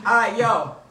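ordinary delivery

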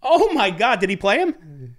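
loud, high-pitched burst of real shock; floored by the news, voice leaping up; astonished. Real, not acted. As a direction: blurt it out in stunned excitement. Learns who played, astonished reaction.

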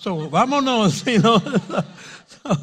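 laughing through the punchline; real laugh mid-joke. Real, not acted.

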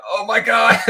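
very high, put-on shocked Oh my God; horrified parent. Parent seeing a Sharpie on the sofa, high shocked squeal. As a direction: squeal it like you just saw the damage. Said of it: "You're like".

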